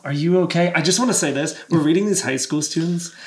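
usual speaking voice

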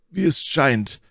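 mildly reluctant admission; hesitant. A narrator's reading. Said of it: "fuhr Athos zögernd fort".

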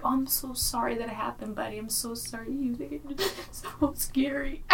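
put-on high, soft baby-talk comfort; soothing voice. Aunt comforting the toddler after his fall, soft cooing. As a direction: coo it to a hurt toddler. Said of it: "I was like".

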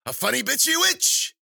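very high, loud crowing; wild, screechy delighted crone; gloating witch. Acted. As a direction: shriek each boast with mounting glee. Witch revels in her joke.

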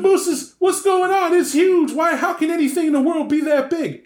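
a man who can't believe what he's seeing; stunned witness. Retold or imitated. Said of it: "listen to them tell the story".

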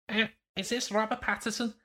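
high-pitched mock girl's voice; mimicked girls. Girls ask the supposed actor on the phone, high mimicked voice.

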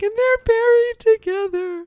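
high, loud mock sob on the words; imitating sobbing. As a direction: wail it like you're choking up reading it. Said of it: "made me sob to read that".

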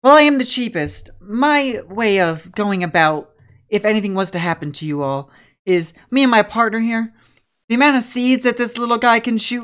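pep her up, eager to be hired; spunky young trainer. Acted. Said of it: "She goes".

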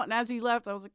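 ordinary delivery

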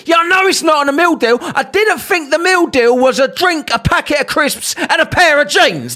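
furious mock retort; sarcastic shout. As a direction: fling it back at her mockingly. Shouting back at cashier, retold.

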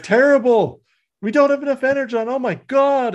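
high, frantic mock outburst; panicked Wheeljack. Engineer telling the leader there's not enough fuel, mock panic. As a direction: complain in a rushed, high-pitched panic. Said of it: "He's like".

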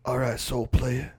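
slow, drawled tough guy; Steven Seagal impression. Co-host imitating an action star, slow drawl. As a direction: imitate a cool action star's lazy speech. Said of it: "the one he's got now where he's like".

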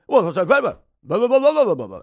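loud babbled blah-blah interruption; mock a politician blustering over others; Tim Scott. Host mimics debater talking over rivals, loud blustering babble. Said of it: "Tim Scott would go".

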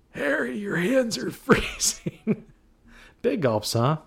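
high, slow, laughing movie line; high, slow quote broken by a laugh; mock movie quote. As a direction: squeak it out like the film, laughing. Quoting Dumb and Dumber.

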